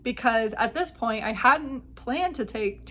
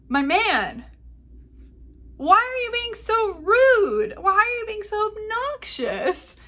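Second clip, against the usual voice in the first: high-pitched, drawn-out mock whine; exasperated inner outburst; 10 semitones higher, 4 dB louder than usual. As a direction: plead in loud, hammed-up exasperation. Voices her internal outburst at him.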